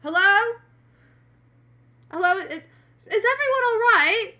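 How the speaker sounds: loud, high, worried call into an empty room; anxious calling out. Acted. Girl checks the rooms after a gunshot, calling out anxiously. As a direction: call out nervously, slowing as you ask. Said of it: "kind of goes".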